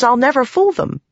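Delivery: high, rushed and anxious; high flustered plea. Acted. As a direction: protest in a nervous rush. Shallan finishing flustered plea.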